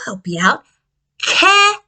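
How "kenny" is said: high squeaky puppet voice. Acted. Puppet introducing himself in a high voice. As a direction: voice the puppet, higher.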